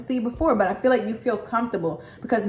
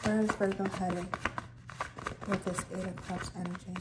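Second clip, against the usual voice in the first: much softer, musing the question to herself; soft repeat; 2 semitones lower, 13 dB quieter than usual. Shown as a demonstration. Tarot reader repeats the card question to herself, hushed and musing. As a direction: murmur it back as if thinking.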